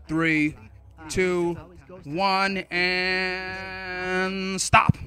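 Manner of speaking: drawn-out, evenly spaced countdown; timekeeper's measured countdown; countdown call. Real, not acted. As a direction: call out each number, stretching into the final stop. Calling countdown over talking students.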